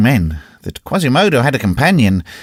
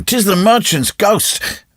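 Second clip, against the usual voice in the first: frightened cry; 10 semitones higher, slower than usual. Acted. Children fleeing in terror.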